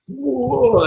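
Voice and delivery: very high, drawn-out whoa; falling troll's whoa. Acted. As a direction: wail it high, sliding down as you topple. Imitates troll reeling as it falls.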